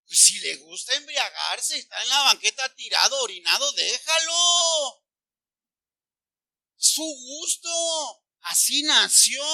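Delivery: hammed-up, stretched excuses; mock permissive parent. Parent excusing son lying drunk outside, mock indulgent.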